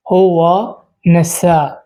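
হুয়া NASA said slowly with long vowels; slow teacher's repeat of হুয়া NASA; slow model pronunciation. Shown as a demonstration. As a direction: drag out হুয়া NASA so learners catch it. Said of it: "তো প্রথম শব্দ হচ্ছে নাসিত".

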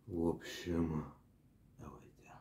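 ordinary talk

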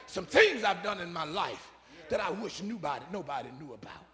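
high, loud quoted preaching; preaching as Scott. Retold or imitated. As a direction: shout the friend's confession to the church. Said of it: "He said".

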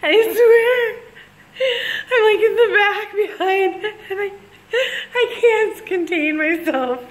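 high, loud words between bursts of laughter; a real laughing fit mid-story; laughing helplessly. Real, not acted. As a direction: lose it completely while trying to talk. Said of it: "Really, I was laughing so hard".

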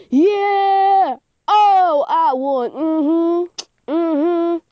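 high, loud whooping; real squealing triumph; ear-splitting victory cheer. Real, not acted. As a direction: crow over your win at the top of your voice. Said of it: "Sorry if I broke your eardrums".

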